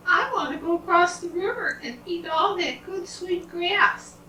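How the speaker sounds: high, thin little goat voice; little billy goat. Acted. Little goat tells family he wants sweet grass, high little voice.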